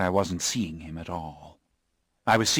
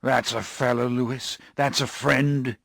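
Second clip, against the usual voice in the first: emphatic thanks to his friend; 6 semitones higher, 5 dB louder than usual. Acted. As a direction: lean hard on each word. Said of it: "he said emphatically".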